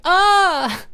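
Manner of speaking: high, loud little shriek; a short mocking yelp; mock short scream. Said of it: "Not like".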